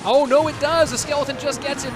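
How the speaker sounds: loud, high burst of surprise; surprised shout. Real, not acted. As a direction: shout it out, caught off guard. Tower shot lands unexpectedly.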